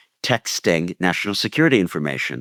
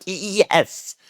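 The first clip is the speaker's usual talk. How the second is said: Yes! jumps much higher, excited; imitating a joyful yes; 11 semitones higher than usual. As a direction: relive the moment of joy. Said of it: "and I'm like".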